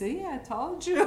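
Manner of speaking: high, drawn-out mock I-told-you-so; triumphant mother. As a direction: crow as the vindicated mother, amused. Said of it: "you know what she said to me".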